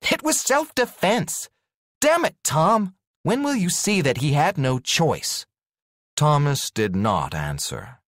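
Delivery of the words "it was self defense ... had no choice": high, louder exasperated protest; angry outburst. Acted. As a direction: snap back at Tom in frustration. Heroine angrily defends Douglas.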